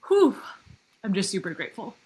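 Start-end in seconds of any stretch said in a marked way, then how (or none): 0.1-0.4 high, loud shaky exhale; emotional whew. Real, not acted. Host steadying herself while thinking of Ian, exhaled whew. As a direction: blow out a breath to hold back tears.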